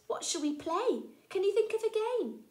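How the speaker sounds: high, loud eager questions; eager child. Acted. Poppet eagerly asking the mouse to play. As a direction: bounce with excitement. Excited young elephant.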